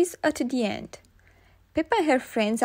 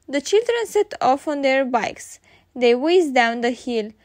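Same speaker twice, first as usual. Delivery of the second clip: ordinary delivery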